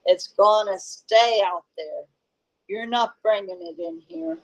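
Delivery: ordinary delivery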